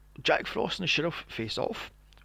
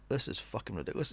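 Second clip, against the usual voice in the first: muttered aside; 6 semitones lower, 11 dB quieter, faster than usual. Retold or imitated. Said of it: "I'm going".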